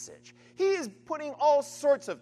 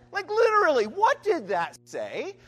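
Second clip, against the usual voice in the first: exasperated loud quiz; 7 semitones higher, 7 dB louder than usual. Shown as a demonstration. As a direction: demand the answer like a baffled professor. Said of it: "the professor in me now wants to give you all the quiz".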